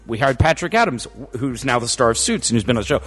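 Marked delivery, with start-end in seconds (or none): none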